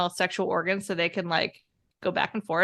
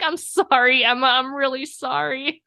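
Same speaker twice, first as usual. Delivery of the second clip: mock apology; 5 semitones higher, 4 dB louder than usual.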